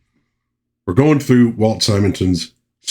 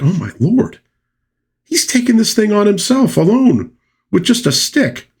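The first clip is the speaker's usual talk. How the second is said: amazed; 11 semitones higher than usual. Retold or imitated. Retold amazed onlooker.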